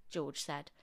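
usual speaking voice

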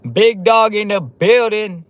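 slow, high, loud hype call; hype greeting. Real, not acted. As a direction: boom it out like an announcer.

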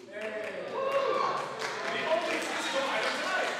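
high, loud, slow showman hype; excited hype. Real, not acted. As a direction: sell it like a game show host. Hyping the painting.

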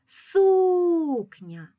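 "Сукня" called out, SU long, high and loud; loud drawn-out call stressing SU in "Сукня"; calling the word. Shown as a demonstration. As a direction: stretch and lift the first syllable SU of "Сукня". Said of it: "Гукаємо".

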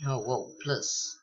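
ordinary talk, nothing marked

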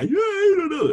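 put-on very high, loud, whiny child voice about to cry; imitating a small child on the verge of crying; crying toddler voice. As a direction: squeal it like a bewildered little kid near tears. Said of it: "they're just gonna cry".

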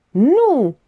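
high, forceful narrated scream; cry out. A narrator's reading. Gheorghiu stops Stratian's revelation with a high cry. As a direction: cry it out high, cutting him off. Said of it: "țipă Gheorghiu".

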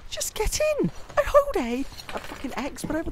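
very high, frustrated outburst; exasperated yell. Real, not acted. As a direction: yell helplessly at the controls. Yelling at unresponsive game.

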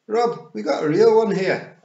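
high, loud Scots call. A narrator's reading. Pixie calling Rob over, high loud shout. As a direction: holler to your chief in high Scots. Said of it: "a pixie called out".

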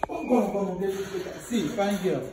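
smooth it over with the guest; soft apology. Acted. Brother apologizes softly to visitor.

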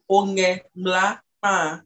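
teacher modelling "Onge mla pa" slowly, word by word; slow careful Dangbe. Shown as a demonstration. Teacher models the southern phrase for a learner, slow and careful. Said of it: "all the Xhosa, they don't say".

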